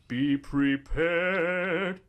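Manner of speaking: slow, dramatic warning; ominous. Pretend. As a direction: say it like an ominous omen. Dramatic closing line.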